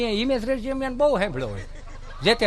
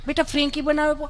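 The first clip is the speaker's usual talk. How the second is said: lightly raised, coaxing mom voice; doting mother voice; 6 semitones higher, 3 dB quieter, faster than usual. Retold or imitated. Mother coaxing her son to eat, lightly doting voice. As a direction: sweetly coax the spoiled child.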